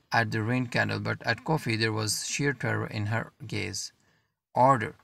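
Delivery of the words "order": loud barked order at full volume; shouted command. A narrator's reading. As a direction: shout the order over the crowd. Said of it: "shouted the command at the top of his lungs".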